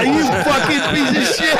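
high, laughing mock insult; cracking up at the doctor's joke. Quoting himself to the doctor, laughing. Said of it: "retold own reaction, laughing".

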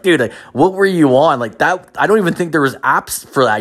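can't believe what he's hearing; incredulous. Retold or imitated. Incredulous reaction to story.

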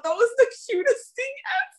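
very high, melting gush; swooning squeal. Real, not acted. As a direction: squeal it out, stretching the end. Swoons over cute rescue.

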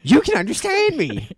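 high, loud squeaky cat voice; a pet amazed it can talk. Pretend. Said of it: "Those are our cat voices".

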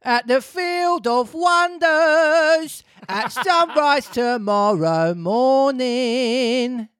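high, drawn-out Pinocchio; high slow puppet voice. Acted. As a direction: stretch it out, high and dreamy. Pinocchio's line in story.

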